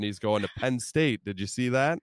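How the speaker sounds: ordinary talk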